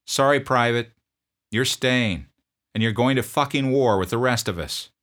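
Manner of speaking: drill sergeant. Retold or imitated. Drill sergeant confronting a sniffling private, loud bark.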